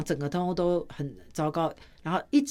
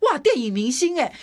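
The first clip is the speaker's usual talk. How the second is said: assistant's exclaim; 6 semitones higher, 4 dB louder than usual. Retold or imitated. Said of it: "我的助理看到我的时候说".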